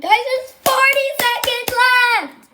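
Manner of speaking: high-pitched excited announcement. Real, not acted. Streamer warns viewers the update is seconds away, excited. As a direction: call out the countdown, voice leaping up. Countdown nearly over.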